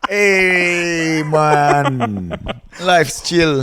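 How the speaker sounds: high, loud Jamaican drawl; Jamaican accent. Retold or imitated. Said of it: "one of my favorite accents".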